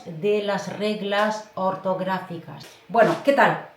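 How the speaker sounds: calm closing wrap-up in Spanish; Spanish recap. Acted. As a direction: sum up the lesson gently.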